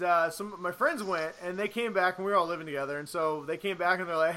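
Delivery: ordinary delivery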